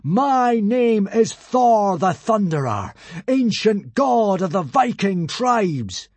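slow, loud, high proclamation; grand, drawn-out thunderous boom; booming god voice. A narrator's reading. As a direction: announce yourself as a mighty god. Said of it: "in a voice he had never used before".

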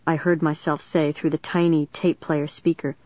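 ordinary delivery, nothing marked

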